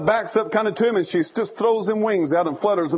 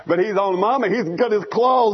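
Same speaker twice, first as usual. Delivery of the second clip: ordinary talk, nothing marked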